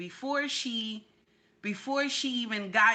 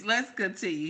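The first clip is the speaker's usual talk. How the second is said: ordinary talk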